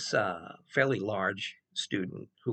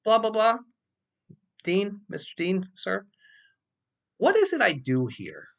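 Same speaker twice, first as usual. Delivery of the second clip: mock formal address; 8 semitones higher, 4 dB louder than usual. Addressing the dean mockingly.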